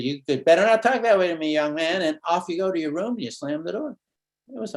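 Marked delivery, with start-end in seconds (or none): 0.0-3.1 a mock stern telling-off, louder; scolding mother. Mom rebuking son for swearing, mock stern. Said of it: "and then she said".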